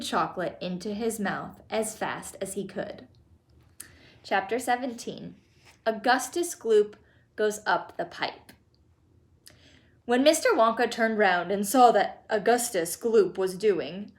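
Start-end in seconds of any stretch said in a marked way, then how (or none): none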